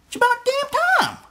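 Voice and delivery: high excited cry of "It's about damn time!", slowed; emphatic exclamation. Shown as a demonstration. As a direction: shout it out like it's overdue. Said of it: "You're supposed to say".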